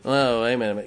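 usual speaking voice

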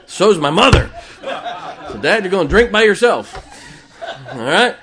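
cracking up while he tells it; laughing loudly. Real, not acted. Preacher laughing at his dad story, laughing loud.

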